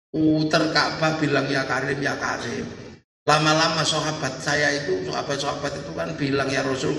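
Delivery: low, earnest storytelling in character; the Prophet explaining. Acted. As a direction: explain patiently to the angel, pitched well down.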